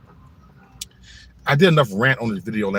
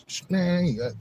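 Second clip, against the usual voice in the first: normal talking voice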